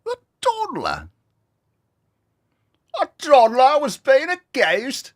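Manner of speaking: very high, loud mock British; British accent, shrieking. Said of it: "making fun of the accent".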